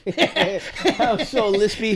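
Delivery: slow laughing self-mockery. Real, not acted. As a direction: laugh through the words. Laughing at own slip.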